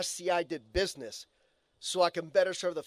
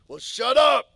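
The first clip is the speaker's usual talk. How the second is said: higher, loud joking snap; mock retort; 7 semitones higher, 11 dB louder, slower than usual. Speaker answering a coworker's teasing, loud mock snap. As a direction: bark it back in mock annoyance. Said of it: "It's like".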